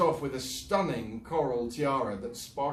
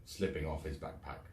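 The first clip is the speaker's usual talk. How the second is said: usual speaking voice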